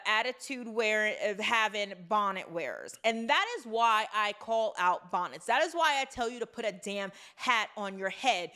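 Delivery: normal talking voice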